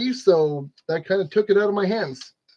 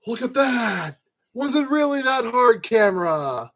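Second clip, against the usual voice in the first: mock exasperation; 4 semitones higher than usual. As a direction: chide the camera in mock amazement. Mock scolding the camera tripod.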